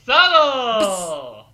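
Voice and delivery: loud, drawn-out mocking exclamation; sarcastic shout. Real, not acted. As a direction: call out the obvious subtext. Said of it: "sarcastic exclamation".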